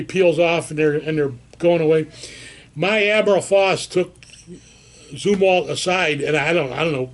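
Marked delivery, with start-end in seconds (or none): none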